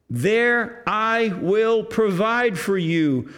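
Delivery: comforting. Acted. Joseph promising care to family.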